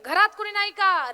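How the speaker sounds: high, loud yell for help; calling out. Acted. Husband stuck at japa calling the house, loud shout. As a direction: holler to the household mid-prayer.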